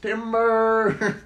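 slow, high mock call of timber. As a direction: stretch it out like a felled tree warning. Calling out like a lumberjack.

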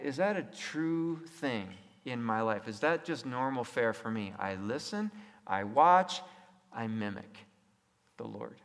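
ordinary talk

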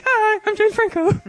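very high squeaky mock voice; mock Franco. Host mocking Franco's photo text in a squeaky voice. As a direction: ham up Franco's greeting.

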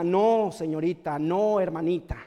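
low, reproving no; chiding. Real, not acted. Preacher chides the mothers who blame bad company, chiding.

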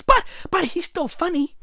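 ordinary talk, nothing marked

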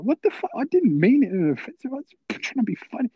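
high-pitched, flustered defence; hammed-up squeal of self-defence; panicked protest. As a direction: plead your innocence, voice shooting up. His panicked defence, retold.

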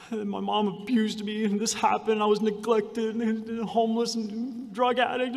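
a touch of imitated tears; retold crying. Woman retelling her story again, mildly tearful. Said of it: "She said, crying".

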